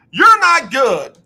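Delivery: high, slow taunting call from the stands; mock heckler yell. Fan heckling the coach from above, mock yell. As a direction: jeer it down slowly, hammed-up. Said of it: "I'm changing the words".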